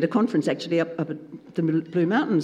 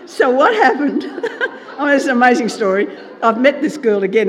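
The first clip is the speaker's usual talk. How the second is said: louder, words broken with laughter; laughing; 4 semitones higher, 8 dB louder than usual. Real, not acted. Preacher to congregation, still laughing, louder words. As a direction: laugh while pushing on with the story. Laughing while resuming story.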